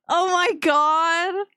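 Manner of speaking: high, long-drawn exclamation; delighted disbelief. Real, not acted. Streamer loving the game, delighted disbelief.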